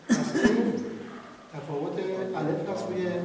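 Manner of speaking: normal talking voice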